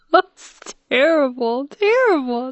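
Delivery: cry-laughing over the story. Real, not acted. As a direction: laugh it out in embarrassment.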